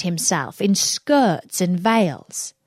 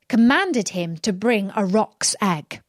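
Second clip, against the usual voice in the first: ordinary delivery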